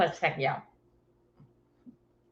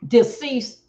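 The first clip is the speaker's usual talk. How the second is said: ordinary talk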